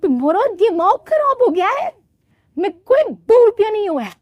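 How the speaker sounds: put-on high, offended young woman voice; indignant bride. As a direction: put the kids in their place. [name] scolds kids. (ऊँची नाराज़ बहू की आवाज़)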